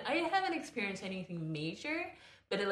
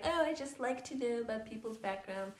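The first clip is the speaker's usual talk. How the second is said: mock innocent asker; 5 semitones higher than usual. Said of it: "people trying to be like".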